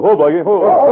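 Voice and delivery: calling to horse. Acted. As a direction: pull the horse up with a called whoa. Reining in his horse.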